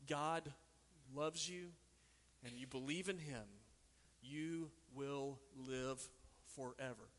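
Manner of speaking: tender. Real, not acted. Slow, quiet reflection on verse.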